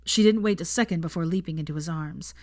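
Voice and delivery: usual speaking voice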